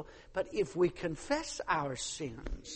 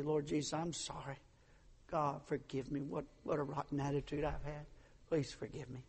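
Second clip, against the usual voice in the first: humble prayer; 3 semitones lower, 7 dB quieter than usual. Shown as a demonstration.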